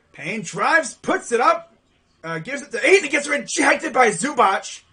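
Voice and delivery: excited basketball call; live play-by-play. Real, not acted. Commentator calls a drive and block, excited play-by-play. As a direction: call the drive, rising with the action.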